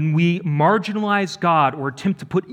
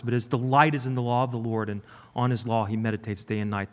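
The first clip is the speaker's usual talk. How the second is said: softer, hushed recitation of the psalm, speeding up; quoting scripture; 5 semitones lower, 5 dB quieter than usual. Shown as a demonstration. Preacher reciting Psalm one to congregation, hushed scripture reading. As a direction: recite it quietly, like a verse you know by heart. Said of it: "Do you remember all the way back to Psalm one".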